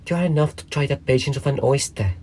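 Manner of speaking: put-on high, peevish young crab voice; a little snappish. A narrator's reading. Young crab scolds her mother, high and mildly snappish. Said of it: "a little snappishly".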